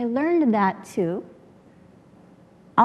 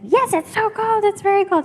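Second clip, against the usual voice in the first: put-on drunk insisting; 11 semitones higher, 4 dB louder than usual. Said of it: "he's like".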